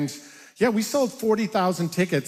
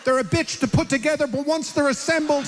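higher-pitched mock stage rant; heated rant; 8 semitones higher than usual. Comedian ranting about fighter jets over a robocall, heated rant.

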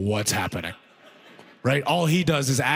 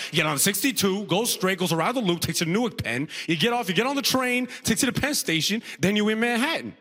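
higher-pitched, confident buddy voice; confident first guy; 5 semitones higher than usual. Acted. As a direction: play the reliable, friendly guy, pitched up. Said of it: "He just repeated the instructions".

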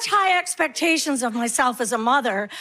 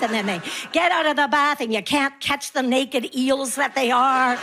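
ordinary talk

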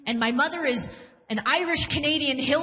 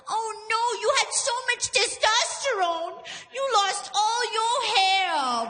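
mock sweet consoling; 9 semitones higher than usual. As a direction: coo it like a fake-sympathetic girlfriend.

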